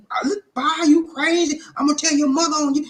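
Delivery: high falsetto, fed-up threat; exasperated high-pitched auntie; scolding auntie. Acted. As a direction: scold him in a high aunt voice. Auntie threatens to tell mother.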